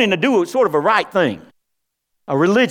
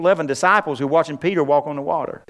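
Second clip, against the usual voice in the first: ordinary talk, nothing marked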